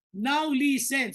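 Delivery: slow it right down and press each word; drawn-out urgent command; slow, emphatic. Shown as a demonstration. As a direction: make them really listen.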